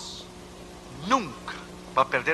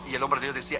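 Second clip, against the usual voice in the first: ordinary talk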